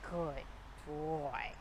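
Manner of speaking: soft, low, unhurried praise for a settling dog; calm, quiet praise. Shown as a demonstration. Trainer praising her dog in his down, calm and quiet. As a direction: keep it calm and quiet so he stays down. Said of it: "And I'm speaking very calmly and quietly".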